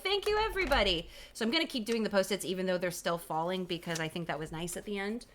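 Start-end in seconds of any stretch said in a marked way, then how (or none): 0.0-1.1 high-pitched, gushing thank-you; bright raised call of gratitude; mock grand thanks. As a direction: sing out thanks to the whole crowd. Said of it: "being like".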